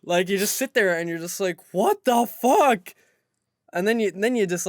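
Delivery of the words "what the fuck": loud, very slow stunned outburst; mock shock. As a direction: drag it out in disbelief at the price. Shocked at store price.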